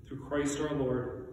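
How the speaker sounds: usual speaking voice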